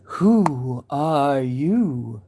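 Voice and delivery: slow, drawn-out caterpillar line; caterpillar quote. Retold or imitated. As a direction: draw it out like the lazy caterpillar. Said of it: "That caterpillar? It's a literary reference".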